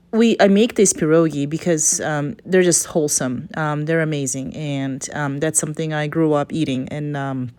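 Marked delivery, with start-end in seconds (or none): none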